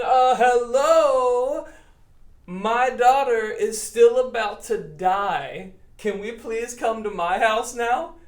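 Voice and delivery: loud, urgent, slow uh hello from a worried father; impatient father. Acted. Jairus urging Jesus on, loud and anxious. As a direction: hurry them along, anxious. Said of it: "he's going".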